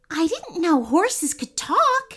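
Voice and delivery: put-on lightly amazed girl, drawing it out; mild surprise. A narrator's reading. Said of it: "she said with surprise".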